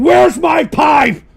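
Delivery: high, loud, slow demanding yell; impatient shout. Real, not acted. Host demanding his pipe, impatient shout. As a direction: yell it, drawing out each word.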